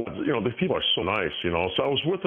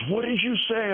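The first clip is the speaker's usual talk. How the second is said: much higher, indignant mock demand; mock indignant woman; 13 semitones higher than usual. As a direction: ape her outrage. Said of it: "She goes".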